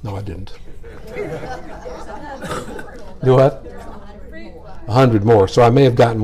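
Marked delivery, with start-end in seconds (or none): 0.6-3.2 a very high, quiet, breathless giggle; high airy laugh. Real, not acted. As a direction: giggle softly, high and mostly voiceless. Laughs at his own slip.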